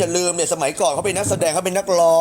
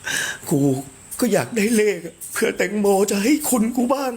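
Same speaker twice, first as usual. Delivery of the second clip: higher-pitched mimicking voice; high mock pleading quote; imitating a friend; 6 semitones higher than usual. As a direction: quote them in a high put-on voice. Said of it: "อีเจติ๊กบอกว่า".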